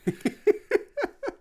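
high mock villain cackle; evil cackle. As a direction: cackle like a cartoon killer. Villain laugh after threat.

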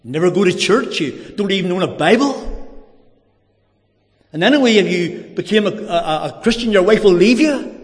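builds from mild to high, loud and urgent; accusing inner voices. Retold or imitated. The voices in his head pressing him, mounting alarm. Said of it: "the voices were saying to me".

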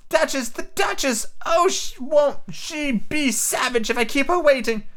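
a frantic rabbit fretting about the Duchess. A narrator's reading.

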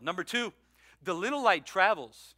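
ordinary delivery

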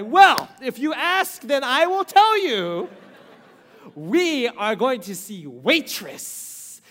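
loud, slow, drawn-out announcement; milk the big reveal; slow showman's reveal of the surprise; grand reveal. Retold or imitated.

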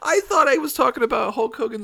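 high, loud, laughing through the words; laughing too hard to finish. Real, not acted. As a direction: splutter it out, cracking up. Laughs at the generated result.

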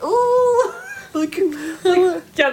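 high, giddy squeal and laugh; nervous excited squeal. Retold or imitated. Fan called out by her idol, giddy high squeal. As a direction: squeal like she's been caught. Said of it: "I'm like".

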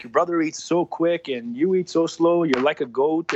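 high, slow, teasing parent voice; mocking parent. Parents comparing him to his brother, slow high mockery. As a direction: drag it out like a nagging parent. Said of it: "They'd be like".

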